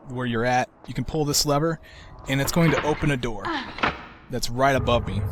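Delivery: usual speaking voice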